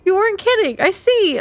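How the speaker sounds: high, squeaky astonishment; stunned disbelief. Real, not acted. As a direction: voice jumps up at the surprise. Stunned by a larger tip.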